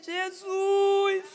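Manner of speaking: high, loud call of Jesus; frantic plea in the delivery room; desperate cry to Jesus. Retold or imitated. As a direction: cry out the name in panic. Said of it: "eu falei".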